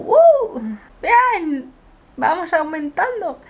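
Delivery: very high, loud cheer; triumphant whoop. Real, not acted. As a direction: celebrate like a win. Celebrating new subscribers.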